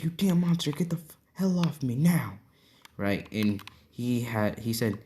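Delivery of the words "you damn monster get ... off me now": higher, strained and breathy rage; half-shouted, half-hissed fury. Acted. Grabbed Bakugo rages at Izuku, high strained yell.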